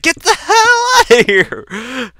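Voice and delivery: long, high, re-enacted scream; yelling. Kid to dad scratching the door, panicked yell. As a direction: stretch it into a high, loud yell. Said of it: "I would always yell out".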